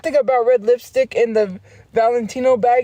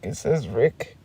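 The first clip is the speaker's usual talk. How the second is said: normal talking voice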